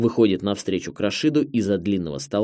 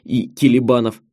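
raised, emphatic, pleased tone; sudden delight; 5 semitones higher, 4 dB louder than usual. A narrator's reading. Director pleased the scriptwriters' recommendation fits, delighted. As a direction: bubble with pleasure as things fall into place. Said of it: "неожиданно радуется директор".

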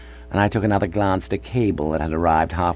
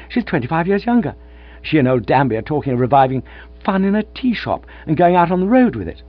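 elated rush; 7 semitones higher, 6 dB louder than usual. Acted. Gussie bursting with happy news.

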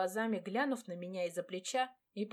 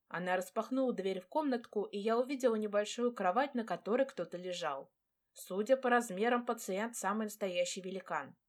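ordinary delivery, nothing marked

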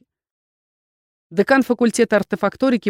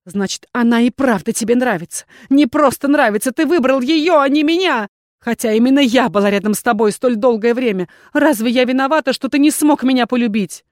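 loud, higher-pitched jealous tirade; shouted reproach; 5 semitones higher, 5 dB louder than usual. A narrator's reading. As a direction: cry out the hurt at his back. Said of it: "в спину ему закричала Виверна".

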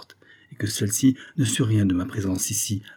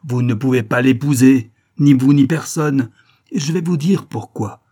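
loud, emphatic yell; forceful shout, pitched up toward the end; shouted protest; 4 semitones higher, 8 dB louder than usual. A narrator's reading. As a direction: hammer each word out at full voice. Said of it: "hurla-t-elle".

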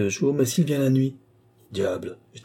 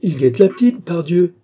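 higher and louder, bursting out with the discovery; excited; 6 semitones higher, 8 dB louder than usual. A narrator's reading. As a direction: seize on the idea triumphantly. Viscount's excited realisation.

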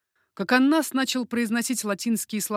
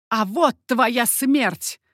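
loud, slow, drawn-out shout; vengeful shout; 3 semitones higher, 7 dB louder, much slower than usual. A narrator's reading. Mother at her window threatening the killer, shouting. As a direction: shout it down, stretching each word. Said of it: "крикнула мать из своего окна".

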